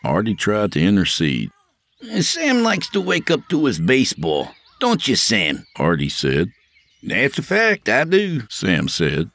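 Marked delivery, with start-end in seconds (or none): 2.2-5.6 high, eager sidekick trying to smooth things over; Artie's eager voice. Acted. 7.0-8.4 higher, light and breezy reply; Sam's easygoing voice. Acted. Sam voiced by narrator.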